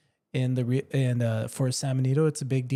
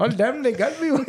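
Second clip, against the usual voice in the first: put-on higher, laughing Eastern accent; 9 semitones higher than usual. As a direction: break into laughter through a bad Eastern accent. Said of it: "a little Eastern".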